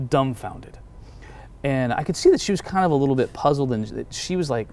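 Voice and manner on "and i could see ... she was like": normal talking voice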